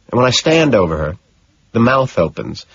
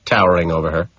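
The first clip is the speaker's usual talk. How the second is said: plain reading voice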